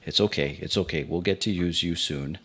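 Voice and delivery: soft, reassuring talk to a pouch; soothing murmur. Acted. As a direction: comfort it quietly, like a small pet. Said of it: "I speak down at the pouch".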